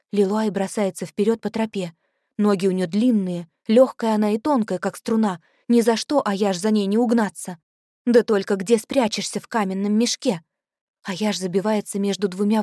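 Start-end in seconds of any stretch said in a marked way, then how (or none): none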